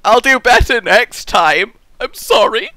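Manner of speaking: high, pleading pretend sorry; mock apology.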